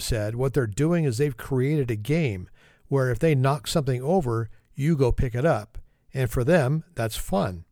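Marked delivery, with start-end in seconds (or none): none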